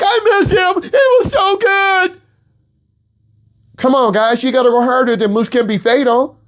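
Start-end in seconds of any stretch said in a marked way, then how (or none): 0.0-2.2 put-on gushing like kids who miss their teacher; future students missing him. Students next year missing their teacher, high and gushing. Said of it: "next year, you're like".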